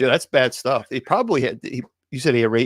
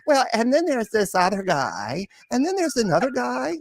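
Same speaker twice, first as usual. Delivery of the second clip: mock her offhand tone; mimicking casual Wilma; 9 semitones higher than usual. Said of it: "you just casually say".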